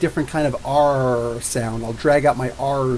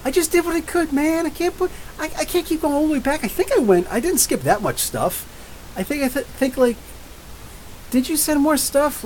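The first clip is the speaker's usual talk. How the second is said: much higher defensive whine; silly high voice; 11 semitones higher than usual. Pretend. Answering chat's complaint in a high whiny voice. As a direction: plead your case in a high silly voice.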